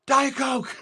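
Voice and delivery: high-pitched quoted outburst, laughing; mock movie yell. Fan quoting a favorite movie, high laughing yell. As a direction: belt it out high, breaking into laughter.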